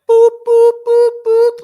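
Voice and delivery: high, loud alarm boops; imitated alarm beeps. Shown as a demonstration. Speaker warning listeners about misusing AI, mimicked high alarm. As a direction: sound a blaring warning siren, boop by boop.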